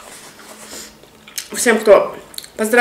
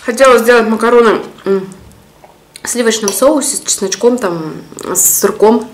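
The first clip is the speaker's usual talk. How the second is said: speaking between bites; talking while chewing. Real, not acted. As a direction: mouth partly full. Seen 0: Mid-meal, lips moving around food while speaking between forkfuls.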